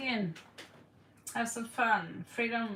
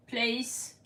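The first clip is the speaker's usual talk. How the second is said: ordinary talk, nothing marked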